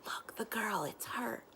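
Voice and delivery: hushed gossiping whisper. A narrator's reading. Boys in the mess hall whispering about Kel, hushed whisper. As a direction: breathe it to your neighbour, excited gossip. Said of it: "she could hear the whispers".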